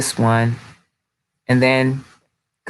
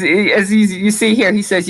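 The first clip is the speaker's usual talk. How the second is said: usual speaking voice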